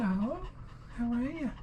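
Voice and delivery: high sing-song greeting for a dog; high pet voice. Real, not acted. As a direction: coo at the dog.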